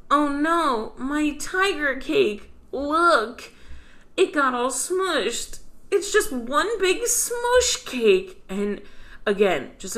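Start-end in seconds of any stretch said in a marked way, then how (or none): 0.1-5.6 put-on high, slow sad kid voice; whiny cartoon kid, drawn out; Daniel Tiger voice. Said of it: "And he goes". 5.9-8.4 high, slow put-on kid voice; cartoon kid voice. Mocking the show in character.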